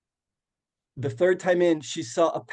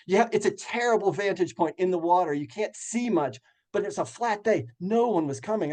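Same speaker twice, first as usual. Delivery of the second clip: ordinary talk, nothing marked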